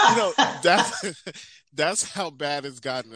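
laughing. Real, not acted. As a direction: chuckle through the admission. Laughing at own story.

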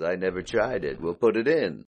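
lightly voiced Larry, barely off his own voice; a halfhearted stab at Larry; Larry impression. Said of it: "my impression's getting worse".

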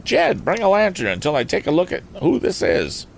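high rustic call to his son; farmer's voice. Acted. Farmer calling his son for a lantern, high rustic order. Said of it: "unmistakably the voice of a farmer".

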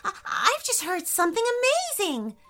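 high, fast, bursting with excitement; can hardly hold it in; barely contained excitement. A narrator's reading. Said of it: "barely able to contain herself".

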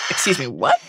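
prim, offended "excuse me, what?"; indignant excuse me. Retold or imitated. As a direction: say it like a shocked polite Brit.

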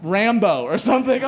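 ordinary delivery

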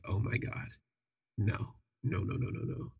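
hushed, breathless 'oh my God, no no no'; fading into a scared whisper; panicked whisper. Retold or imitated. Narrator recalls spotting someone behind him, dropping to a panicked hush. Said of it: "I'm like".

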